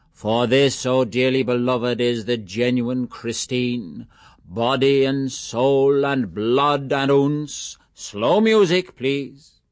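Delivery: slow, high, hammed-up sermon voice; mock priest intoning. A narrator's reading. Mulligan mocking a priest at the parapet, slow pompous intoning.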